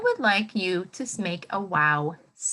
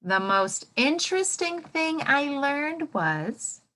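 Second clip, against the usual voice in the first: slow, raised sentence starter for the class to finish; slow prompting frame; 6 semitones higher, slower than usual. Shown as a demonstration. Teacher prompting students to complete the frame, slow and higher. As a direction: lead the class into the sentence slowly. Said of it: "get ready".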